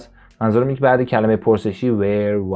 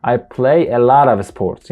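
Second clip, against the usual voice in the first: very slow, louder model sentence; slow loud demo; 5 dB louder, much slower than usual. Shown as a demonstration. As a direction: drill it for learners. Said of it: "نه". (آهسته و بلند برای تکرار)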